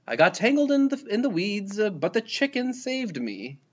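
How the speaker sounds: high-pitched storybook character voice; rescued little frog. A narrator's reading. Rescued frog explains to friends.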